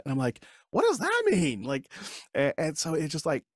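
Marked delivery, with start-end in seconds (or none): 0.7-1.6 incredulous what. Retold or imitated. Baffled husband reacting.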